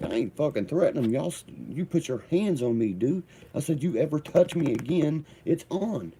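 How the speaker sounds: squaring up, voice rising; retold defiant yell, pitch up; heated retort. His heated reply to coach.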